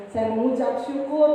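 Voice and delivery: ordinary talk, nothing marked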